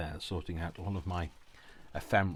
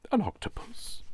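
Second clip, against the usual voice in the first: squeaky high. Pretend. Octopus said oddly high.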